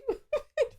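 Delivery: squeaky giggle; real high laugh; high burst of laughter. Real, not acted. Laughing at her own story.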